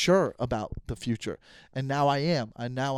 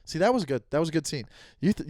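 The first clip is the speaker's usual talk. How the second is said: ordinary delivery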